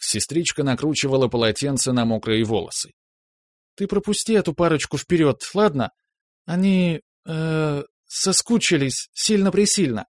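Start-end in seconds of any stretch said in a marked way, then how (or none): 3.8-10.1 teasing little sister. A narrator's reading. Nastya teasing from the bathroom.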